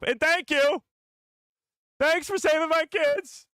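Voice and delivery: loud, very high falsetto thanks; hammed-up squeal of gratitude; mock grateful mom. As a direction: shout it in a silly high woman's voice. Mock farewell from the hologram mom.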